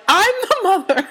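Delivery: high, mock-indignant mom voice; hammed-up high motherly declaration; imitating mother. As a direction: mimic your mother pulling rank, pitched high. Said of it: "Ma's favorite thing to say".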